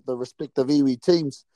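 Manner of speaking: normal talking voice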